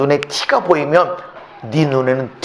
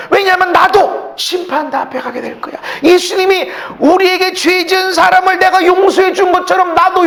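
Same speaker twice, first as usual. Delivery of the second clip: much higher, loud preaching shout; fiery preaching; 5 dB louder than usual. Acted. Pastor exhorts church to forgive, shouting fervently. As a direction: thunder it from the pulpit.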